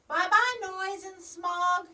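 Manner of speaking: high bright call, slowing. Acted. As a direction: call it out cheerfully. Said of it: "she called".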